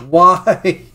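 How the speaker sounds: ordinary talk, nothing marked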